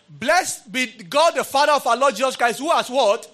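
lifted, ringing reading of the verse; fervent scripture reading. Real, not acted. Preacher proclaiming Ephesians to the congregation, high and loud. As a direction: proclaim it to the church.

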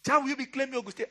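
high, fast imitation of an indignant mother; mother's voice.

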